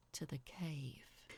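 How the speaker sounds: ordinary delivery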